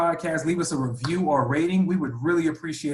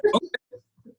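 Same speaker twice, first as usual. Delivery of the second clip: mock surprised okay. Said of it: "I was like".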